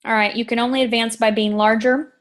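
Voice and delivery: usual speaking voice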